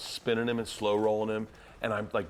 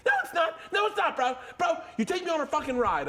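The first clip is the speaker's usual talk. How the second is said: very high yelling imitation; mock indignant protest. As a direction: argue back in an outraged high voice. Acted quarrel with fuming friend.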